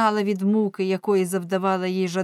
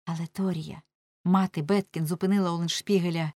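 ordinary delivery

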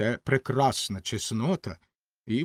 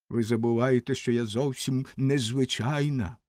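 plain reading voice

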